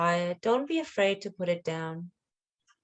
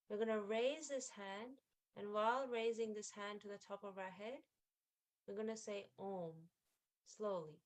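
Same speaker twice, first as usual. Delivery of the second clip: very soft, unhurried instructions; hushed meditation leader's voice; guided meditation; 14 dB quieter than usual. Acted. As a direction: guide them gently, leaving pauses between phrases. Guides hand movement in meditation.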